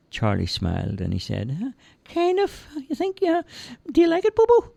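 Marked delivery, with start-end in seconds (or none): 1.6-4.7 put-on high, childlike simple lad voice; cheerful high-pitched lad; Charlie's voice. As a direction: play the big innocent lug, high and eager. Charlie answering Bobo.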